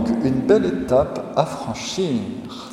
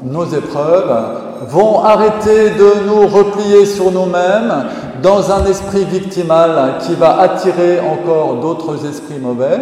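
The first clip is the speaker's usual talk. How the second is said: loud, high, slow sermon climax; fervent preaching; 6 semitones higher, 7 dB louder than usual. Acted. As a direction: drive the warning home to the congregation. (prédication fervente et forte)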